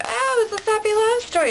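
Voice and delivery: high, gushing mock swoon; teasing a sappy romantic, high and gushing. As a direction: gush like a lovesick fan, hammed up. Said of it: "I'm like".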